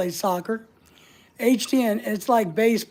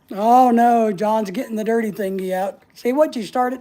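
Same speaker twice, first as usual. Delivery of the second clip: ordinary delivery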